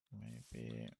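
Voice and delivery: ordinary delivery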